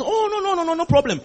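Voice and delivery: high, cooing "no problem"; high-pitched reassurance. Acted. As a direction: brush the worry away in a high voice. Reassuring a member worried about maths.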